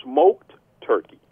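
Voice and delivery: slow, punched food-ad delivery; savoring ad read. Acted. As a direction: let each ingredient land with a long pause. Listing sandwich ingredients in sponsor read.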